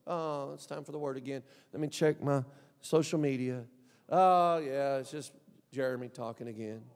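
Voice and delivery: mock a bored churchgoer muttering. Pastor mocking a bored listener in the pews.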